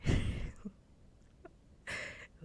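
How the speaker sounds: short hushed burst of laughter; breathy laugh. Real, not acted. Streamer laughing after correcting a viewer, breathy laugh. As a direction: laugh briefly, mostly on breath. Amused after blunt answer.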